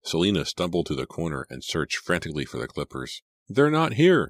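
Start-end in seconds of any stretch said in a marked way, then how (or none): 3.5-4.3 high, loud, panicked exclamation; distressed cry. A narrator's reading. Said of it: "she cried".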